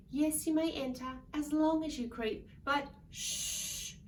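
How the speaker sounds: put-on loud, lively read-aloud for kids; storybook reading. A narrator's reading. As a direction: read it big for a room of children. Reading picture book to children.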